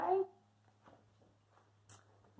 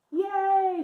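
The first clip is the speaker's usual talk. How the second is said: excited cheer; 10 semitones higher, 9 dB louder than usual. Acted. Story ends happily.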